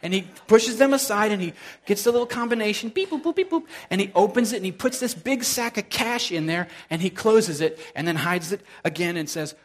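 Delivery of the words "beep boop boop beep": keypad beeps. Retold or imitated. Imitating safe keypad.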